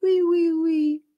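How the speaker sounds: put-on high, slow, begging like a small child; little child pleading. Shown as a demonstration. Child asking mum please in a high, slow whine. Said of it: "que ferait un petit enfant".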